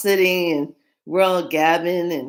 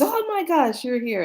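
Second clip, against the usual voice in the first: squealed high and delighted; excited high greeting; 11 semitones higher than usual. Retold or imitated. Client spotting the reader in the shop, high excited squeal. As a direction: gush it out like seeing an old friend. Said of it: "and she goes".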